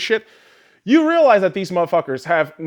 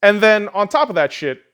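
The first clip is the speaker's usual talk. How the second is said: usual speaking voice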